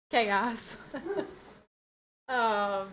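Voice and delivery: ordinary talk, nothing marked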